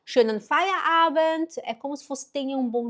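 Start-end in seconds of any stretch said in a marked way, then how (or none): 0.0-1.5 Schönen Feierabend slow and higher, friendly farewell; German phrase. Shown as a demonstration. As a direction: say Schönen Feierabend cheerfully to colleagues. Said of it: "você diz". (Schönen Feierabend dito devagar e mais agudo)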